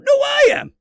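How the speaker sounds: high, loud mock shout; mock villain shout. Host acting a villain argument, high mock shout. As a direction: shout back like a squabbling villain. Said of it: "It's me, the Mirror Master".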